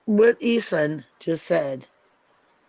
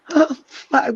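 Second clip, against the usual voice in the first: bitter laugh; 8 semitones higher than usual. Real, not acted.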